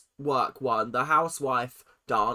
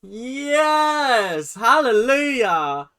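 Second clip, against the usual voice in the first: loud high joyful cheering; excited cheer; 6 semitones higher, 7 dB louder, much slower than usual. Real, not acted. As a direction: celebrate like a win at last. Excited [name] can win.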